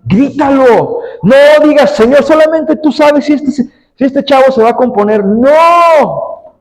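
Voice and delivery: high-pitched, forceful exhortation; impassioned preaching. Acted. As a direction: urge them hard, ending on a long cried-out refusal. (predicación exaltada que culmina en un grito)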